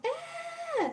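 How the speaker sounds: high-pitched mock kid scream. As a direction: squeal like kids on a bus. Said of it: "you hear the kids screaming on the bus".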